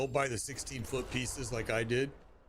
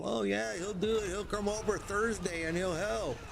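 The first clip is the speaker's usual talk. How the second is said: higher-pitched, chirpy imitation of a wife; volunteer him in a high mock-obliging voice; imagined eager wife; 8 semitones higher than usual. Wife offering husband's help to friends, imitated obliging.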